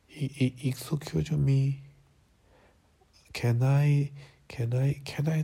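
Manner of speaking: slow, faltering English; halting. Acted. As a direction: stumble through the English words. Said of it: "더듬더듬 말했지".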